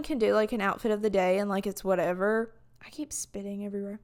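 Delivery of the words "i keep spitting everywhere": whisper. Real, not acted. Whispered aside about spitting.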